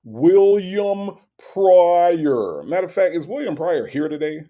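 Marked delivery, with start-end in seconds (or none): 0.0-2.6 very slow, stretched, higher-pitched name; mock someone oddly dragging out their own name; mimicking drawn-out name. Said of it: "why does he say his own name like that?".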